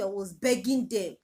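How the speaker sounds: ordinary talk, nothing marked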